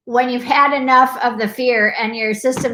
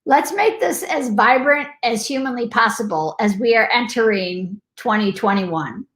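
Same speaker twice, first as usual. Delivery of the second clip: ordinary delivery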